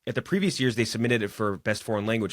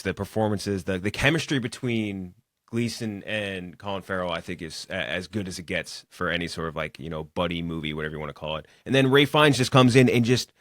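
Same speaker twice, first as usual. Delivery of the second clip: usual speaking voice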